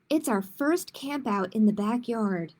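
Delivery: put-on reading to children, clear and lively; picture book read aloud; story narration. A narrator's reading. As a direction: tell it like storytime for kids. Librarian reads picture book aloud.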